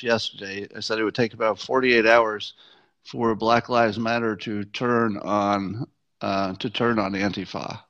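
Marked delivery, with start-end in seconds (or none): none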